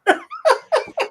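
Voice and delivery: squealing laugh; high burst of laughter. Real, not acted. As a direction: break into shrill laughter. Reacts to hypno voice.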